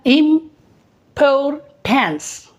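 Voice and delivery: "Importance" split into slow syllables, im-por-tens. Shown as a demonstration. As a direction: break "importance" into syllables slowly. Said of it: "तो इसमें कितना है?". ("Importance" धीरे, syllable तोड़कर)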